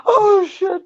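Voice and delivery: high, laugh-choked exclamation; helpless with laughter; cracking up. Real, not acted. Buddy hearing the tramp stamp punchline, cracking up.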